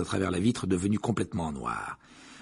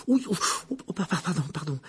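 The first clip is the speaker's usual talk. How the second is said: flustered stammer; 7 semitones higher, faster than usual. Acted. Neville stumbling, stammering.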